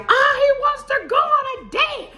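high, loud panicked squeal; stretched-out freak-out yell; panic mode. Retold or imitated. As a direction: shriek the news to girlfriends in hammed-up panic. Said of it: "I'm in a full panic mode".

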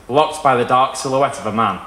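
ordinary delivery, nothing marked

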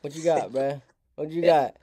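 ordinary delivery

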